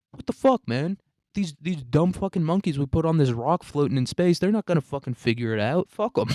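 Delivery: high, exasperated outburst; acted worked-up dismissal; indignant rant. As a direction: vent in disbelief as the blamed employee.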